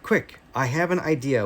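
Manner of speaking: ordinary delivery, nothing marked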